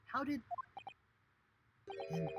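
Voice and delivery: usual speaking voice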